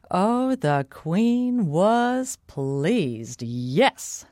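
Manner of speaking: very slow, drawn-out relish; savouring. A narrator's reading. Narrator tells of the pleased queen, slow savouring. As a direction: linger on each word with satisfaction. Storyteller savours happy ending.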